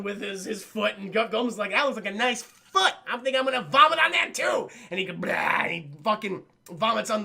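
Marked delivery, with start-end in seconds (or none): 1.7-4.7 loud, high mad-villain shout; gloating monster. Acted. Voicing Goldblum relishing a cruel attack. 5.1-5.3 spew out a mock vomit noise; imitated vomiting.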